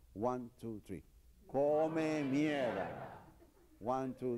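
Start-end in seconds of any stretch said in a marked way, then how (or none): 1.5-2.9 each syllable of "Come mierda" drawn out slowly; slow drill word. Shown as a demonstration. Teacher modelling "Come mierda" slowly for students. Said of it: "say the word".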